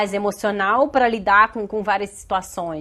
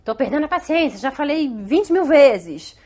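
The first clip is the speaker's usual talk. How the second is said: mãe sem paciência; 3 semitones higher, 4 dB louder than usual. Acted. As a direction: act out a parent at the end of their patience. Acts a parent losing patience. (mãe perdendo a paciência, exasperada)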